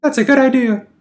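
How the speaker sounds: high, sugary consoling woman's voice; sweet clingy girlfriend. Acted. Anna soothing hurt Jack, high syrupy coo. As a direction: coo at him like she owns him.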